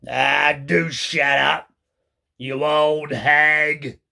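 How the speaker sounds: lightly voiced slow grumbling husband; grumpy old man. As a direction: drag it out like a sour old man. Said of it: "Mister Twit said".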